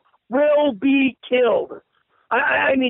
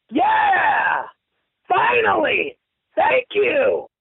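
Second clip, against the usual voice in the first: relieved shout; 7 semitones higher, much slower than usual. Real, not acted. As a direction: yell out that someone finally sees it. Relieved someone agrees with him.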